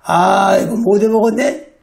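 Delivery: lightly voiced demon, pitched low; low, fed-up grumble, imitating a demon; a hint of a demon giving up; grumbling demon. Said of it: "귀신이".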